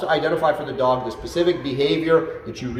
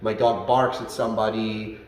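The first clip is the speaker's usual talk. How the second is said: normal talking voice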